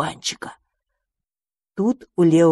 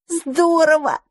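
high, bright burst of delight; dandelion thrilled by the mouse's story; 10 semitones higher, slower than usual. Acted. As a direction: gasp it out in admiration. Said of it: "восхитился одуванчик".

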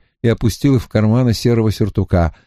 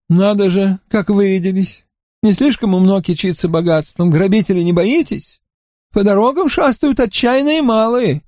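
languid remark; 9 semitones higher than usual. A narrator's reading. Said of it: "вяло заметил".

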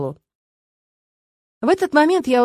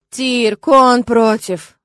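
drawn-out, emphatic exasperation; slow, loud, fed-up stress on the words; exasperated eye-roll; 3 semitones higher, 6 dB louder, much slower than usual. A narrator's reading. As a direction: stretch it out as if stating the obvious. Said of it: "закатила глаза я".

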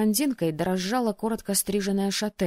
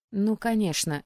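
usual speaking voice

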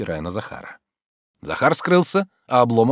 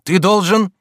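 loud, high, pressing demand; agitated urging; 11 semitones higher, 8 dB louder, slower than usual. A narrator's reading. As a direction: insist with agitation. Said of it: "в волнении торопил его Обломов". (взволнованно, с нажимом)

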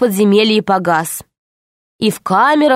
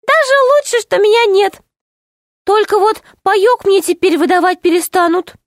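high, tittering little gnome; giggly gnome; 11 semitones higher than usual. Acted. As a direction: giggle through it as a tiny gnome. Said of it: "Гном хихикнул". (хихикающий гном)

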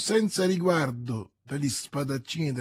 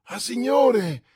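high, drawn-out cry of relief; agitated exclamation; 9 semitones higher, 6 dB louder than usual. A narrator's reading. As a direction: burst out at the master's return. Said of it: "esclamò questi".

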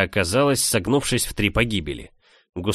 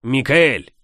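loud, higher urgent cry; urgent exclaim; 4 semitones higher, 4 dB louder than usual. Acted. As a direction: blurt the name out. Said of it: "выдохнул он".

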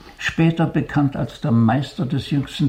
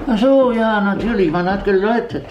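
sudden realization; 8 semitones higher, 4 dB louder than usual. Acted.

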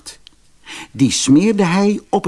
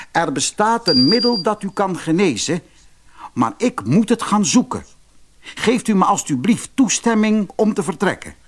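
a son begging his father, pitched up; earnest prince; 8 semitones higher than usual. Acted. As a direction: ask politely but urgently. Eldest prince asks father.

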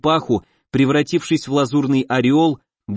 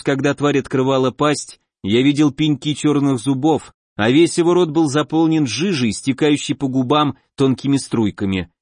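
ordinary delivery

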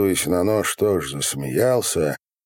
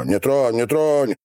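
higher-pitched repeated warning; sharp warning calls; 7 semitones higher than usual. A narrator's reading. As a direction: order him off with rising force. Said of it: "покрикивали на него". (предостерегающий окрик)

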